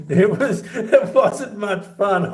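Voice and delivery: words breaking up in laughter, slow; can barely get it out for laughing. Real, not acted. Retelling the lime bag mishap, laughing through it. Laughing while telling story.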